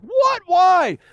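mock teenage outrage; baffled teens. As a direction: play the kids who can't believe it. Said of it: "And they're like".

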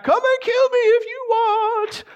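very high, stretched-out silly dog voice; clueless exposed dog. Acted. Dog exposing its vital organs to intruders, goofy high holler. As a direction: sing out a goofy, oblivious invitation. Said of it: "They're like".

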